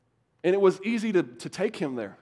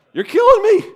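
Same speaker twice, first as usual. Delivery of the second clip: high, loud mock-agonized cry; exasperated; 13 semitones higher, 12 dB louder than usual. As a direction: burst out in comic exasperation. Acted exasperation at slow talker.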